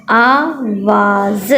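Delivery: आवाज़ stretched out very slowly, ending on a rise; teacher blending the word for kids. Shown as a demonstration. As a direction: drag out आवाज़ so each sound is heard. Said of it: "पूरा लफ्ज़ क्या बना?".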